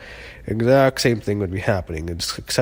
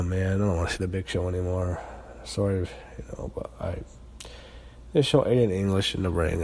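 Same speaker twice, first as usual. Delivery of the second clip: ordinary talk, nothing marked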